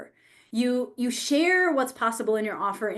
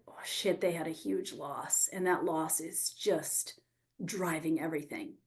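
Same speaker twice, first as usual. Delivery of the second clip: whispered inner thought; 6 semitones lower, 10 dB quieter than usual. Retold or imitated. Inner realization voiced.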